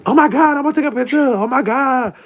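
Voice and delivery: squealing high, excited fan voice; starstruck fan. Retold or imitated. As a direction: gush like a starstruck fan begging for a photo. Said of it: "people really, like, be on some shit like".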